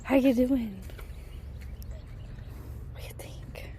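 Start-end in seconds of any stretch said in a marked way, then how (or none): 2.9-3.7 soft whisper. Real, not acted. Asking her horse's opinion in a whisper. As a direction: breathe the question.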